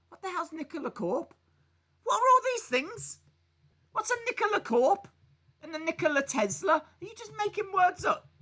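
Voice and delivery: high, incredulous questions; pretending never to have heard of them; mock bafflement. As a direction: play dumb, squeak out the disbelief. Mock baffled at unknown names.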